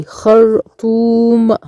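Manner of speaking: خرطوم very slowly, syllable by syllable, long oo; elongated madd. Shown as a demonstration. Teacher giving an example word, slow stretched خرطوم. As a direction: draw out the طو in خرطوم. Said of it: "لازم نمد كده شوية".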